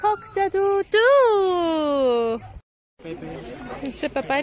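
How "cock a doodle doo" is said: rooster crow. Pretend. Rooster sound for the kids.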